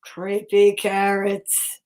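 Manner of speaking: low, slow, eerie creepy voice. Acted. Repeating the title to spook kids, low and slow. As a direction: growl it slow and low like a ghost.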